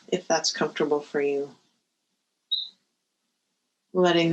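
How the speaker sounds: guided meditation. Real, not acted. Teacher settling listeners before the bell, guided meditation.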